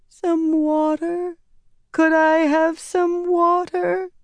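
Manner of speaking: put-on very high, slow, frail girl's voice; sick girl begging. A narrator's reading. Sick girl asks Nynaeve for water, very high, slow and frail. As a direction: beg weakly for water like a feverish child. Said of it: "a young girl said from the next bed".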